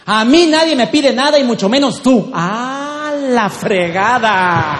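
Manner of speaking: loud, high outburst, drawn-out curse; husband still shouting. Acted. Husband refusing his wife's request, furious mock shout. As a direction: roar back, stretching the final curse. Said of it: "sigue en su papel".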